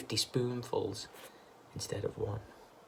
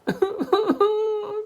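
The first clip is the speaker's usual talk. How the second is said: wailing cry; 6 dB louder than usual. A narrator's reading. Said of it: "cried Missus".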